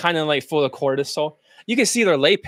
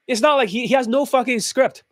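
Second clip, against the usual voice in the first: usual speaking voice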